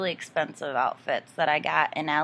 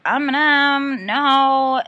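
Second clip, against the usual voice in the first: incredulous reaction; 7 semitones higher, 8 dB louder, much slower than usual. Retold or imitated.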